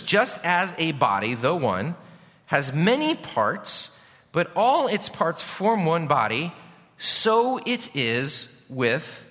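drawn-out, slow, deliberate reading of the verse; slow scripture reading. Shown as a demonstration. Pastor reads the verse slowly to the congregation, drawn out. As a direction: read each phrase slowly so they follow. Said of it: "can you see that?".

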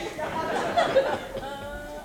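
sharp, high cackling laugh; high cackle. Acted. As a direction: cackle at your own dark joke. Laughing at his own cannibal joke.

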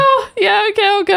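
high, whiny mock compliance; tipsy, crestfallen. Semi-drunk fan told to go, tipsy and crestfallen. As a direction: give in with a hammed-up tipsy whine. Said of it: "I'm, like, semi-drunk too".

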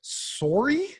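mock Canadian accent, long O with questioning rise; Canadian "sorry". American puzzled by a newcomer's word, exaggerated Canadian accent. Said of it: "when an American hears a Canadian accent".

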